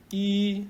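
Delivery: E held high and level; first tone. Shown as a demonstration. Teacher counting tone one, high level E. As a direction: hold E on one high note.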